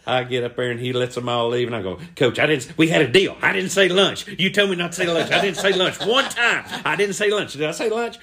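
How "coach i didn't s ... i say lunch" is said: higher, insistent protest; indignant pleading. Acted. Boy protesting to coach about their deal, indignant. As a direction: argue your innocence, pitched up.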